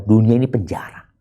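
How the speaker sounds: ordinary talk, nothing marked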